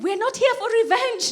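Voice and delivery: high-pitched, hurried pleading to wait; acted urgent reassurance; placating. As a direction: call after someone to calm them down.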